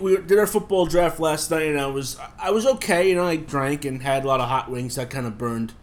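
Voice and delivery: ordinary delivery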